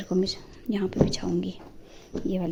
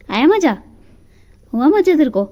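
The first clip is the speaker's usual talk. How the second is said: loud, higher mocking taunt; teasing the cat; 5 semitones higher, 12 dB louder than usual. Real, not acted. Vlogger teasing the cat stuck under the stairs, loud playful taunt.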